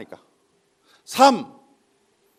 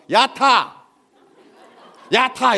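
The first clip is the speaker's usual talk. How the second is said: loud, raised-pitch hail; punchy mock shout to a passer-by; calling out; 5 semitones higher, 6 dB louder, much slower than usual. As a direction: holler it like offering a ride. 거북이가 지렁이를 불러 태움.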